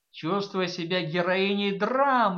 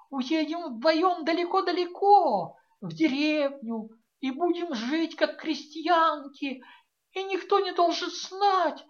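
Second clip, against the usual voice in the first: high, loud desperate pleading; pleading daughter; 11 semitones higher, 4 dB louder than usual. A narrator's reading. A daughter begs her mother to leave with her, high and pleading. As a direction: beg like a frightened girl.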